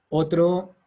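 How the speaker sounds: ordinary talk, nothing marked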